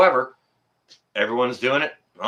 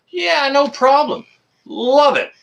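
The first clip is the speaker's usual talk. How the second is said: high, mock-cheerful "no problem"; sing-song sarcasm about helping strangers; sarcastic cheer; 14 semitones higher, 4 dB louder, slower than usual. Real, not acted. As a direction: chirp it brightly, full of sarcasm. Sarcastic cheer, much higher.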